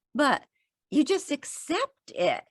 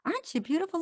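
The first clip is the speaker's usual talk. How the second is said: soft, higher-pitched murmur of admiration; tender admiring coo; 8 semitones higher, 5 dB quieter, much faster than usual. Acted. Telling listeners to greet a flower, tender admiring coo. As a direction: coo it gently, lifting into a question. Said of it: "look at a flower and say".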